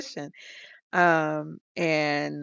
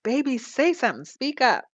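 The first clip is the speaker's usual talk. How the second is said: raised, quick pleading; pleading groom; 8 semitones higher, 3 dB louder than usual. Retold or imitated. Groom begging his silent bride at the altar, higher and hurried. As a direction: hurry her to answer at the altar.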